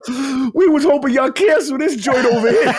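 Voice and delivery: high, loud comic complaint; mock dismayed protest. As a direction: wail it jokingly, breaking into laughter. Acts out developers' dismay, laughing.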